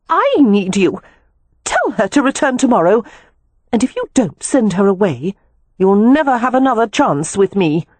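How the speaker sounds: high-pitched, insistent woman; demanding lover. A narrator's reading. Spurned woman threatens the lover leaving her, demanding.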